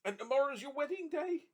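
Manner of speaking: high, loud joyful cry; cried out joyfully. A narrator's reading. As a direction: burst out with delight to his daughter. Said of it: "cried Trotty".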